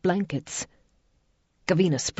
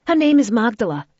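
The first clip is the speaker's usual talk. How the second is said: 1 swiftly; 7 semitones higher, 8 dB louder than usual. A narrator's reading. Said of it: "Gavina said swiftly".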